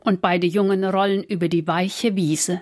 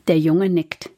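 ordinary delivery, nothing marked

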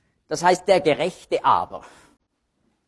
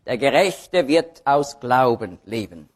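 slow, low line with Gerechte picked out; stress on Gerechte; 5 semitones lower than usual. Shown as a demonstration. Preacher quoting the verse for Romans, stress on "Gerechte". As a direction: lean on "Gerechte", then let the rest fall. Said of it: "jedes Mal liegt die Betonung aber ein bisschen anders".

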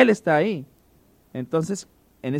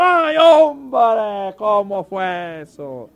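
much higher, louder cry, then slow question; excited fan; 6 semitones higher, 6 dB louder, much slower than usual. Retold or imitated. Preacher now hooked on baseball, excited to a friend. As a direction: yelp "Ay" then stretch the question out. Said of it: "le estoy diciendo al otro".

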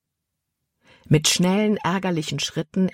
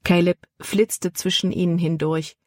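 plain reading voice

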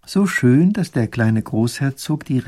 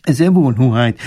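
höher und lauter, dienstbeflissen; höflicher alter Minister; 8 dB louder than usual. Acted. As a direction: der alte Herr bestätigt ergeben. Minister antwortet der Prinzessin. (alter Minister, höher und lauter)

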